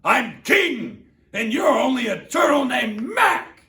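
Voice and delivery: high, loud domineering boast; thundering royal voice. Acted. Yertle thundering at Mac to stay in his place. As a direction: lord it over the little turtle. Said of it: "his royal voice thundered".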